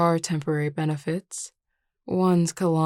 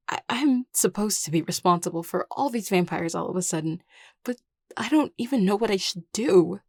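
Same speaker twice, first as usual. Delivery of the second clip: higher-pitched, stammering distress; overwhelmed; 3 semitones higher than usual. Acted. New crown confessing inexperience to a friend, overwhelmed. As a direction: let the worry spill out, pitch climbing.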